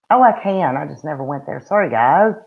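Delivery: ordinary talk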